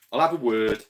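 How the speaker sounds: loud mock-tough announcement; loud declaration. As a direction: belt it out like a playful threat. Playfully offering to step in.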